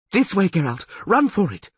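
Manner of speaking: high, loud urgent cry; urgent shout. A narrator's reading. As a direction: call him to run now. Urgent call to flee.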